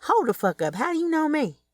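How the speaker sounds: sharp, high Chicago street attitude; Southside mode. Acted. Kelly snapping at a stranger who knows her, sharp Southside attitude. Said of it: "she snapped into her Southside mode".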